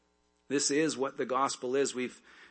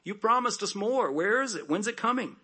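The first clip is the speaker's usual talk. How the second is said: higher, impatient whine; whiny complainer; 8 semitones higher than usual. Retold or imitated. Complaining people voiced.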